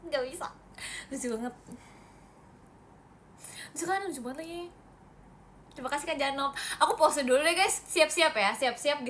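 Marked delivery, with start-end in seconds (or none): none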